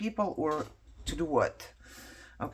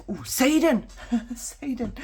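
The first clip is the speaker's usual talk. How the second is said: amused exclaim; 8 semitones higher, 6 dB louder than usual. Real, not acted. Reading a funny word.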